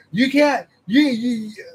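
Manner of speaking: high, loud sputtering mock of a complaining gamer; hammed-up indignant stammer; mocking whiny fan. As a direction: mimic the stammering outrage. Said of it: "They're like".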